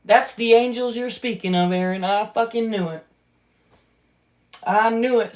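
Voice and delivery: normal talking voice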